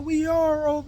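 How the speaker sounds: high-pitched mock wail; imitated crying. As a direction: mimic a tearful woman protesting, high. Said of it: "she was over there crying".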